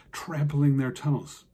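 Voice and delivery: ordinary delivery, nothing marked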